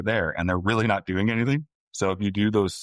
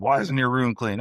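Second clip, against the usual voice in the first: higher-pitched exasperated parent demanding an answer; mock annoyed parent; 6 semitones higher, 3 dB louder than usual. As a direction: imitate a nagging, exasperated parent. Acted parent-child argument.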